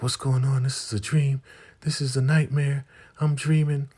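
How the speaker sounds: high, loud mock panic; panicked waking. Wife waking in the torture chamber, mock panic.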